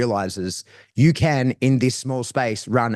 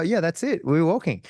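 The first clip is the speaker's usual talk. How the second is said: praise to dog; 6 semitones higher than usual. Retold or imitated. Handler praising dog on leash.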